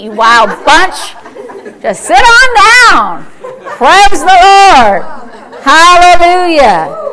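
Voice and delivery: normal talking voice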